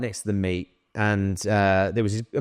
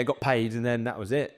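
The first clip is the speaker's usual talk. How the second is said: ordinary talk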